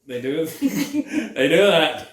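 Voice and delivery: laughing through the words; can't stop laughing. Real, not acted. Laughing at the movie.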